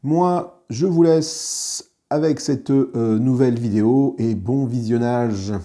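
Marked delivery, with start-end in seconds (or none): none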